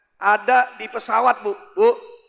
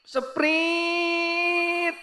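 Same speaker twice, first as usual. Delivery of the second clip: high, long-drawn "sprint"; thin hissing stream noise, stretched out; imitated trickle sound; 7 semitones higher, 4 dB quieter than usual. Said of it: "Kalau dia kencing". (tiruan bunyi pancuran nyaring)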